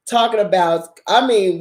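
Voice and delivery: ordinary talk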